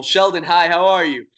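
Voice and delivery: greeting live viewers one by one; shout-outs. Real, not acted. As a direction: call out names warmly and brightly. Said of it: "Shouting out some people".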